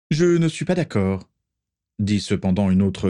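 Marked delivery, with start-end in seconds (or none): 0.1-1.3 put-on young man voice. Son objects to father.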